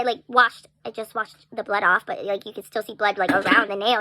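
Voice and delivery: ordinary talk, nothing marked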